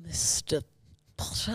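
Severus Snape. Shown as a demonstration. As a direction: address the pupil softly, pausing between words. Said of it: "Severus Snape".

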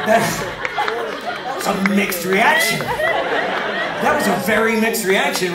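loud, high, slow laughing drawl; amused mock complaint. Real, not acted. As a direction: savor the flop, laughing. Mocking the crowd reaction.

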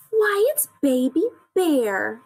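high, loud, slow surprise; surprised discovery. A narrator's reading. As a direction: exclaim at finding someone unexpected. Coco finds the lost baby.

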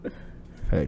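normal talking voice

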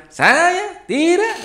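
put-on very high, slow, drawn-out Papuan accent; playful Papuan accent impression; imitating Papuan speech. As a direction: mimic a Papuan speaker's high tone. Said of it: "cara ngomongnya itu biasanya agak tinggi ya".

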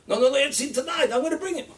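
high, loud retold protest; urgent insistence. Rabbi overrules the psychiatrist about tonight's visit, urgent insistence. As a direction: cut off the objection with urgent insistence.

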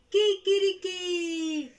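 very high, loud, slow kikiriki; stretched-out rooster call; rooster crow. Acted. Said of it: "El gallo dice así".

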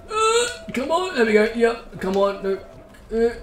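high-pitched strained urging; tense squeak. Real, not acted. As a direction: squeal it under pressure. Tense moment in race.

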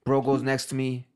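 normal talking voice

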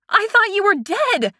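high, overwhelmed outcry; emotional cry. A narrator's reading. Woman hugging the friend she thought dead, crying out. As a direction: cry out in shocked relief.

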